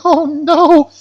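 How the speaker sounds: high, squeaky mock fright; scared. Scared fish seeing the breeding pair, mock fright. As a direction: yelp like a frightened little fish. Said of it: "they're scared".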